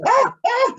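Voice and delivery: high mock cries of panic; panicked yelp. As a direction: yelp high like you're being stabbed. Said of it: "He goes".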